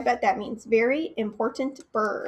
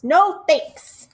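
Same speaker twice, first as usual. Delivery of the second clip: grumpy refusal; 4 semitones higher, 9 dB louder than usual. Acted. Grumpy bird refuses acorns.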